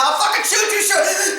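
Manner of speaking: high, shouted threat, half laughing; panicked screaming. Retold or imitated. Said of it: "I start screaming".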